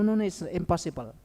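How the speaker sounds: ordinary delivery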